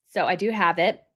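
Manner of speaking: normal talking voice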